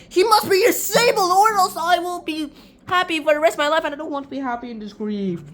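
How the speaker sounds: high, loud melodramatic rant, easing toward the end; overwrought uncle. Acted. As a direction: declaim it in mock despair. Uncle rants about his son.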